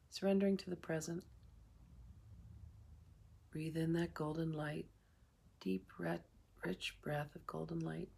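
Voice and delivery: calm meditation guide with long pauses between phrases; guided meditation. Real, not acted. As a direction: ease listeners through each breath, unhurried.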